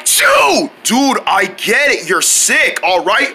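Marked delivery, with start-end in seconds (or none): 0.0-0.7 high, explosive achoo; huge fake sneeze. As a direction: build into a comic shouted sneeze. Huge sneeze after a series.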